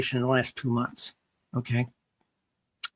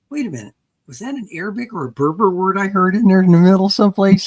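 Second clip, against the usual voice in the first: louder, higher, quicker retold surprise; surprised quote; 4 semitones higher, 9 dB louder, faster than usual. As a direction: mimic someone catching a foreign word. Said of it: "I've had people say".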